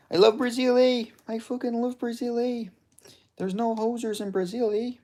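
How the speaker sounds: very high-pitched hammed-up Canadian; Canadian accent. Streamer declares love for Brazil, mock Canadian accent. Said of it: "my Canadian accent is good".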